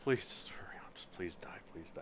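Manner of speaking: hushed whisper, urging under the breath; imagined prince whispering a secret wish; impatient whispered pleading. Pretend. Imagining King Charles wishing at a bedside, impatient whisper. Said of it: "just imagine Ch-, Charles, the king now".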